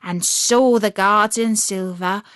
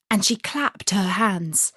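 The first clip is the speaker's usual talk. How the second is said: plain reading voice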